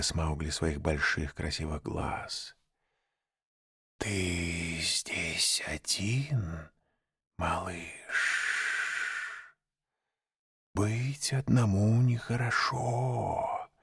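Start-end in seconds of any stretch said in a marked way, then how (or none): 4.0-13.7 slow, hushed serpent voice; sly snake whisper. A narrator's reading. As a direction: lure the child softly. Snake coaxes the lone boy.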